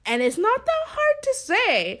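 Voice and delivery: high mock voice of the coworker; hammed-up imitation of the coworker. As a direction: parrot her in a high voice. Mocking coworker's words.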